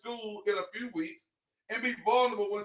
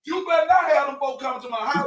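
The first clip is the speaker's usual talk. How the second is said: loud, raised-pitch mock warning; neighborhood warning; 5 semitones higher, 9 dB louder than usual. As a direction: lay down the house rule at full volume. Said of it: "that's how they talk".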